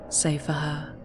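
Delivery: plain reading voice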